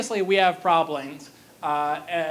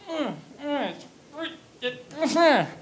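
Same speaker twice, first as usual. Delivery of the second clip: wordless hums; 8 semitones higher, slower than usual. Retold or imitated. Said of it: "Sieglinde's like".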